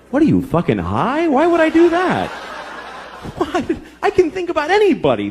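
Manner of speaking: mock wife question and retort. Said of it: "My wife's like".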